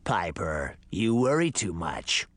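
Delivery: low, creaky ancient man. Acted. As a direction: creak it out like a parched old man. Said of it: "a creaky voice said".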